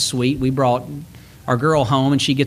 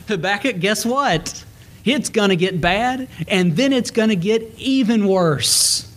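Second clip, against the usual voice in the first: high, gleeful teasing; teasing, high mock glee; 7 semitones higher than usual. Preacher teasing the prophet Habakkuk, high gleeful taunt. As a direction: taunt with sing-song glee. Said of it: "teasing the prophet".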